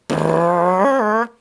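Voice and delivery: loud lip-buzz fart, high; rolling fart noise. Retold or imitated. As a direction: trill out a fake fart. Another fart imitated.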